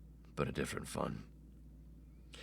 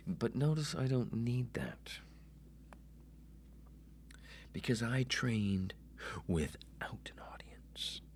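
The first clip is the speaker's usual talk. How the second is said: hushed unvoiced aside; whisper; 3 semitones higher, 8 dB quieter than usual. Real, not acted. Host confiding to listeners, whispered. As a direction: breathe it as a secret.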